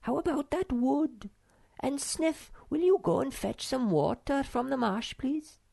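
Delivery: a sister waving the worry away; Snork Maiden voice. Acted.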